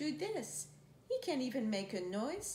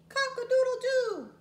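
loud, high rooster crow; 12 semitones higher, 9 dB louder than usual. Shown as a demonstration. Reading to a child, crowing like a rooster. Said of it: "like a rooster".

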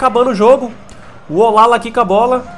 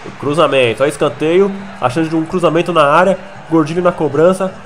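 ordinary talk

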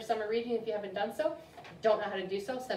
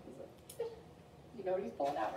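usual speaking voice

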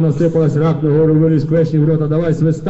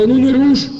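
sharp warning shout; 9 semitones higher than usual. Acted. Cossack stopping the nobleman, high sharp shout. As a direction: snap at him to back off.

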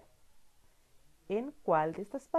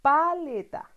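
higher and louder, bright reveal; announced answer word; 8 semitones higher, 7 dB louder, slower than usual. Shown as a demonstration. Teacher to child, higher louder reveal of word. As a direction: present the word with a lift. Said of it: "la última imagen es".

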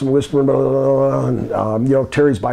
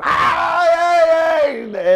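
much higher alarmed cry; imitated yelp; much slower than usual. Imitating Coco crying out in the car, much higher. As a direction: yelp like a man grabbed. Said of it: "I hear Coco go".

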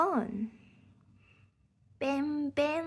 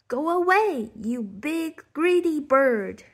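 loud, higher shouting; 4 semitones higher, 8 dB louder than usual. Acted. Posy shouting from behind the bush at the bird, loud shout. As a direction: chase the bird off with your voice. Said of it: "shouted the voice again".

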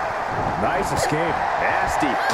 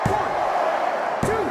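excited pin count; 12 semitones higher than usual. Acted. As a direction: count the pin with rising hype.

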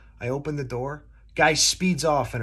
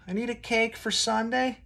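high, lightly voiced old grandma; a playful higher grandma voice; grandma on the phone; 9 semitones higher than usual. Grandma calling the bakery, high old lady voice. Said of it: "someone's grandma".

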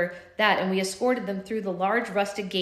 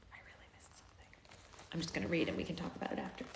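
whispered aside; whispering aside to a friend mid-recording; 15 dB quieter, faster than usual. Real, not acted. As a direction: breathe it out under the reading. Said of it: "aside while reading".

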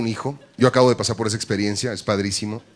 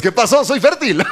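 high, loud cocky brag; mock swagger; 11 semitones higher, 5 dB louder, slower than usual. Fertile man bragging to a girl on the street, high cocky call. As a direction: strut it, showing off.

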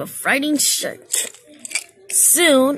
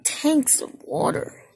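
ordinary delivery

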